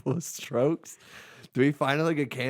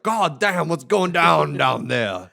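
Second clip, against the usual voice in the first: loud, slow Aussie outcry, higher; Australian accent; 5 semitones higher, 9 dB louder, much slower than usual. Retold or imitated. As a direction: shout it skyward like Steve Irwin. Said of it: "Steve Irwin's like".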